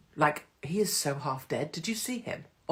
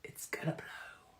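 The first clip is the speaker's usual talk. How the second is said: voiceless, much softer; whisper; 14 dB quieter, slower than usual. Real, not acted. Hinting at summer unrest, much softer whisper. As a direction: breathe it like a secret. Teasing prediction about summer.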